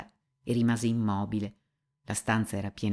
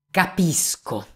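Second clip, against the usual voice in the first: a sudden cry of understanding, higher; loud exclamation; 7 semitones higher, 3 dB louder, slower than usual. Acted. Mitya to the officials, a sudden loud cry. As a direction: blurt it out at full voice. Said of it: "esclamò forte con tutta la voce che aveva".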